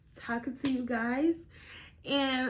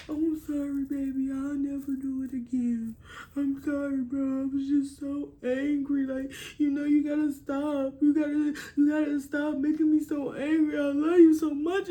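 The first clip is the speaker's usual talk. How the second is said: put-on pleading boyfriend, growing louder to the end; abuser's fake apology; 3 semitones higher, 5 dB louder than usual. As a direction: beg sorry while blaming her. Acted abuser apologizing after hitting.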